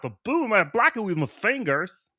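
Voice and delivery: put-on high, wild over-the-top comic accent; silly high-pitched generic accent; Doom. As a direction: boast like a goofy foreign cartoon villain. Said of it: "maybe that's what Doom should be".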